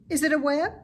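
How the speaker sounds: a curious little pig asking; high pig voice. Acted. Wilbur asking Charlotte about the bridge, high and curious.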